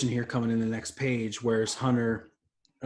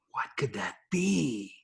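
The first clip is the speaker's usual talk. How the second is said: mock wondering; 4 dB quieter, slower than usual.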